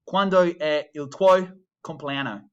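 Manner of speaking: "Quando è il tuo compleanno?" with English vowels, "compleanno" as kom-plee-AN-oh; bad English-accent Italian. Shown as a demonstration. Teacher showing students the wrong way, English-accented. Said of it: "a bad way of saying that might be".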